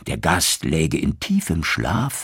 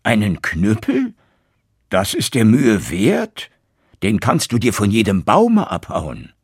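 put-on louder, disbelieving old father; scoffing father; 3 semitones higher, 5 dB louder than usual. Old tailor scoffing at his son's cudgel, loud and disbelieving. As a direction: scoff at the worthless stick. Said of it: "rief der Vater".

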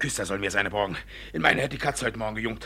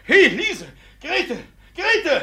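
calling out; 9 dB louder, much slower than usual. Acted. Judge calls maids in panic.

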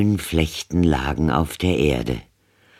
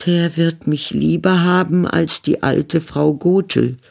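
put-on high, light girlish voice; a lightly voiced maiden; young girl's thought; 12 semitones higher than usual. A narrator's reading. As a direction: think it to herself like a young girl. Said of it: "so dachte sie".

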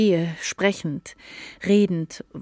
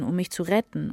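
ordinary delivery

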